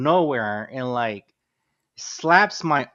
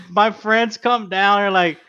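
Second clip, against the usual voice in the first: usual speaking voice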